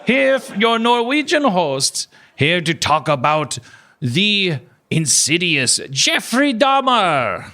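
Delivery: high, slow, sing-song host greeting; playful imitation of a Norwegian true crime host; Norwegian podcast host impression. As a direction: ham up the cheery Norwegian host. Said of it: "It's this Norwegian guy".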